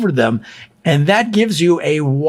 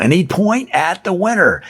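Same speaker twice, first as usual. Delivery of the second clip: usual speaking voice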